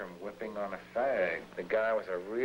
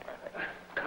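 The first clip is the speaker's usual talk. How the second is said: ordinary delivery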